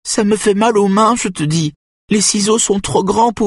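very high, distressed whine; protestation plaintive. A narrator's reading. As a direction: protest in a high pleading voice until cut off. Woman protests, pleading to be spared. (plainte affolée, très aiguë)

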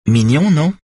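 high and pleased with herself; proud. Acted. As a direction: show it off proudly. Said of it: "dit-elle fièrement".

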